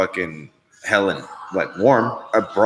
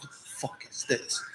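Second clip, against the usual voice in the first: unvoiced whisper of shock; breathless whisper at the cold wind; mock whisper; 3 semitones higher, 16 dB quieter than usual. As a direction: hit by wind round a corner, whispered shock. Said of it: "You're like".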